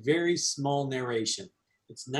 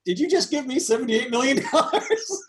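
much higher, laughing disbelief; mock astonishment; 9 semitones higher, 4 dB louder than usual. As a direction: squeal in delighted disbelief.

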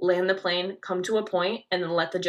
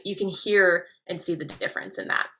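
ordinary delivery

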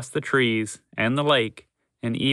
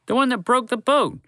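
shouted; 10 semitones higher, 3 dB louder than usual. A narrator's reading. Zoo worker spots the horse, loud high shout. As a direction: yell it across the park. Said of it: "a voice shouted from behind them".

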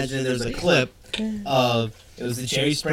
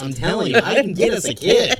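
mock scheming penguin; 6 semitones higher, 5 dB louder than usual. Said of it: "voicing penguin plotting".

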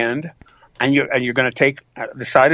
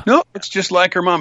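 higher-pitched mock disagreement; contradicting onlooker; 6 semitones higher than usual. Second onlooker disputing the resemblance, higher objection. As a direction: counter it in a lightly acted higher voice.

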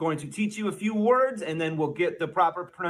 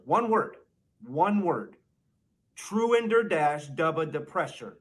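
normal talking voice

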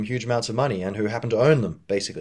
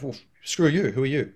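higher-pitched mock indignant retort; resisting; 5 semitones higher than usual. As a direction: push back as the offended person. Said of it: "they'll just resist you".

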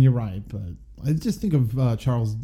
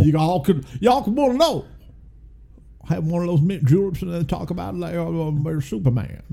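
put-on higher, playful Southern accent; hammed-up Southern gentleman; 6 semitones higher, faster than usual. Continues Southern lawyer bit.

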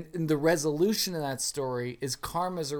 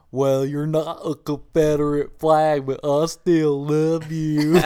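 louder mock Southern drawl, slow at the end; Southern gentleman accent; 3 semitones higher, 10 dB louder than usual. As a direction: ham up a Southern gentleman's courtship. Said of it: "the Southern gentleman".

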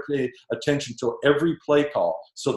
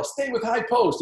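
retold shout from the bench, pitched high; coach yelling; 10 semitones higher than usual. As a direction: call the play out across the court. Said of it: "So he'd yell".

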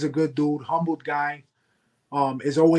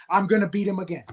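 ordinary talk, nothing marked

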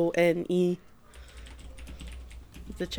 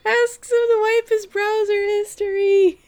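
much higher, louder squeal; excited; 6 dB louder than usual. Real, not acted. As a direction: squeak it out in disbelief. Excited high-pitched outburst.

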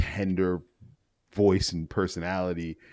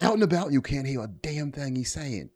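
put-on higher-pitched Australian wildlife-host accent; Crocodile Hunter Australian; 6 semitones higher than usual. Mocking his brother's bad signal, excitable Australian nature-show host. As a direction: exclaim it like an Aussie nature presenter. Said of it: "kind of Crocodile Hunter style".

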